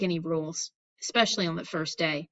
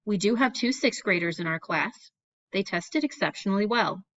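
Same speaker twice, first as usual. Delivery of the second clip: ordinary delivery, nothing marked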